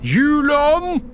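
loud, very high plea; desperate cry. A narrator's reading. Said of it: "ropte Scrooge desperat". (desperat, høyt rop)

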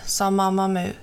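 ordinary delivery

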